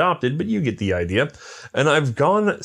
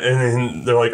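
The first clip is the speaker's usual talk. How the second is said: ordinary talk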